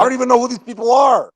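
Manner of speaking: fast, high, loud shout; yelling from the sidelines. Real, not acted. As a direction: yell at the TV in disbelief. Fan yelling at game.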